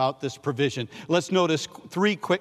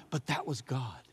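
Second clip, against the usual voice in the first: hushed; 3 semitones lower, 10 dB quieter than usual. Acted. Refrain contrasting human helper.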